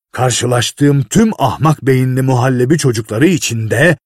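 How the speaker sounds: a lightly voiced harsh boss barking orders; bully the clumsy boy into doing it right; a hint of an impatient, scornful master; gruff foreman.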